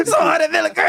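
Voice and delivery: high, shouted comedy-bit voice; Chris Rock. Retold or imitated. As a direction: shout it like a stand-up punchline. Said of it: "It's like a Chris Rock bit".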